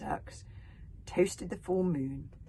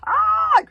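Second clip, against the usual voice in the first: piercing high shriek of shock; shocked screech; 17 dB louder than usual. Acted. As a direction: shriek as if struck. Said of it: "screeched Alvin the treacherous".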